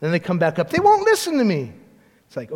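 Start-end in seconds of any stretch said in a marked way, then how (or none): 0.7-1.8 high-pitched imitation of a kid; complaining child. Child reporting back to parent upstairs, high complaint. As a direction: plead like an ignored child, pitched up.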